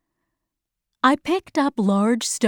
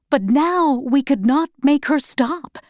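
plain reading voice

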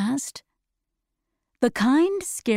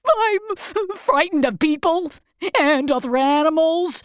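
starting to cry; 6 semitones higher than usual. Acted. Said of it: "and he started to cry".

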